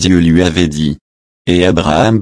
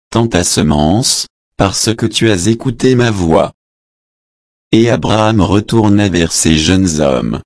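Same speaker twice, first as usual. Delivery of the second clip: plain reading voice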